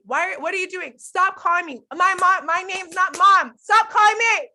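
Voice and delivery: high-pitched scolding, building to a loud shout; constant yelling. Retold or imitated. Mom re-enacting what her kids constantly hear, yelling. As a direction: re-enact a fed-up mom's rapid-fire orders, ending in a yell. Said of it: "it's constantly yelling at them".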